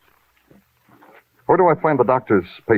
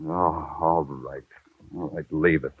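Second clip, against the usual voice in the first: tired resignation; 3 semitones lower, 9 dB quieter, slower than usual. Acted. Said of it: "you sick or something".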